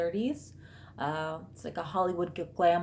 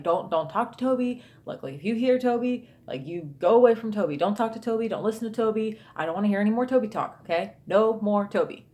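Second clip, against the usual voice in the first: imitating a husband's firm, raised-pitch lecture; mock scolding, insistent and emphatic; lecturing rant; 3 semitones higher, 3 dB louder than usual. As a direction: rattle off stern orders like a fed-up dad. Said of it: "lecturing, like, kinda going off a little bit of a rant".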